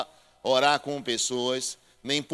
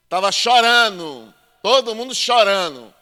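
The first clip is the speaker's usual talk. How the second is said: loud, slow and higher, hammering each word; emphatic preaching; 5 semitones higher, 9 dB louder than usual. Acted. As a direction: lean into it from the pulpit.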